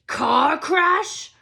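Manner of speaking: loud outraged bellow; roar. A narrator's reading. Hagrid at the Dursleys, a loud outraged roar. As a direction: roar it back in disbelief. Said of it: "roared Hagrid".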